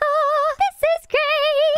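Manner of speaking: put-on delighted girl voice. Singer delighted.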